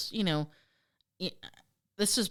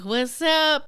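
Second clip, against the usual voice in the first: higher, louder cocky alien hello; joking alien greeting; 5 semitones higher, 4 dB louder than usual. Acted. Aliens show off to the farmers, playfully cocky. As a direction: call it out like a prankster alien.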